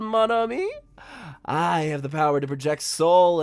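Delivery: high, loud and gloating; smug Shell voice. Acted. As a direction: sneer like a villain showing off a stolen body. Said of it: "and Shell goes".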